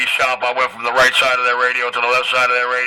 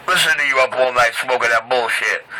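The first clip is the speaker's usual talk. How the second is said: ordinary talk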